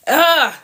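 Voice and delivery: high, loud exasperated ugh; frustrated groan. Real, not acted. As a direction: groan at your own voice. Frustrated by coughing fit.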